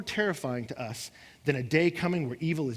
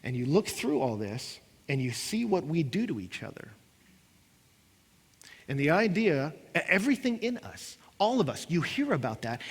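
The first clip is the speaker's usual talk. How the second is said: ordinary talk, nothing marked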